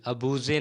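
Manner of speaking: अबूजे read as written, ending in a voiced "-zay"; wrong pronunciation. Shown as a demonstration. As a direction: misread it as three syllables, a-boo-zay: अबूजे. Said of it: "नहीं". (गलत उच्चारण: अबूजे, आख़िर में "ज़े")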